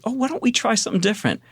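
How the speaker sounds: high, quick imitated wife voice; suggesting wife. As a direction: mimic your wife breezily pivoting. Imitating wife who could pivot.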